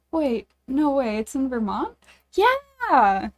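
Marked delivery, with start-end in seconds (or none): 2.3-3.3 excited. Real, not acted. Cheering on the Vermont setting.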